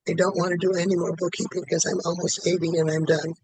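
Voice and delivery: ordinary talk, nothing marked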